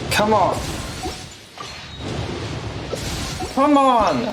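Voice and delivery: high exasperated groan of come on; frustrated plea. Real, not acted. Streamer pleading with the gacha for a win, exasperated high plea.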